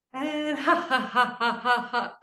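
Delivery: rhythmic ha into giggle; laughing on the breath out. Shown as a demonstration. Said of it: "One more big breath in".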